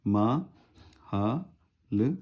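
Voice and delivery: slow, separated syllables for मा हा ल; syllables drawn out. Shown as a demonstration. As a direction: stretch each syllable of मा हा ल apart. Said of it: "महल".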